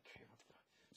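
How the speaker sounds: whispered inner panic; bewildered whisper. Retold or imitated. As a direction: whisper it to yourself, at a loss. Retold bewildered thought mid emergency.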